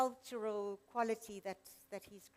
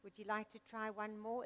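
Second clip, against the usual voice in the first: usual speaking voice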